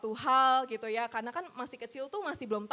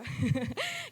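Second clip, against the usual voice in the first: short high laugh; 13 semitones higher than usual. Real, not acted. Laughs at her own result.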